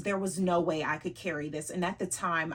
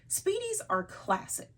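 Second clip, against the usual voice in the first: much higher, sing-song insistence; voice rising; 13 semitones higher than usual. Shown as a demonstration. Vlogger insisting to viewers, much higher voice. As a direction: lift the whole line up high. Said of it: "you can hear in the influx in my voice".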